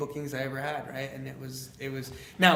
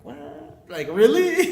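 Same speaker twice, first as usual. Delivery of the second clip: small "what" leaping to a high, loud "really"; shocked; 6 semitones higher, 10 dB louder, much slower than usual. Retold or imitated. Young speaker told he can be paid, shocked. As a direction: act out stunned disbelief, building sharply.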